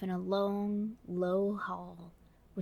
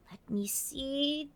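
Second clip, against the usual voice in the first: musing whisper; 6 semitones higher, much slower than usual. Acted. Alice thinking.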